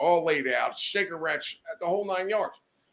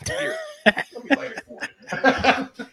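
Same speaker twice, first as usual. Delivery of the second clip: laughing through it; 4 dB louder, slower than usual. Retold or imitated. Offering [name] a cigarette, laughing.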